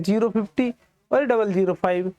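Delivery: normal talking voice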